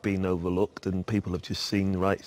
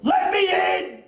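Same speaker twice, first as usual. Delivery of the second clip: high, slow, loud plea to be let in; desperate shout; 5 dB louder than usual. Acted. Actor in rehearsal begging to be let in, desperate shout. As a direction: yell it at the top of your voice, dragged out.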